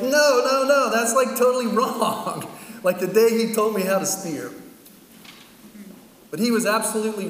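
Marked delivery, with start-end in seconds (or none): 0.0-2.5 loud, high, laughing dismissal; mock scoffing. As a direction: brush it off as nonsense, amused. Crowd scoffing at Jesus' words.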